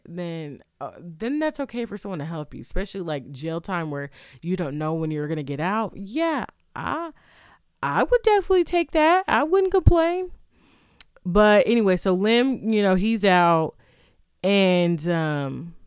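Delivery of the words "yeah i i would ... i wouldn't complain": high and loud, eagerly insisting; emphatic agreement. Real, not acted. To the viewers about accepting a favor, eager loud insistence. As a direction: pitch it way up, like of course I would. She'd gladly accept help from jail.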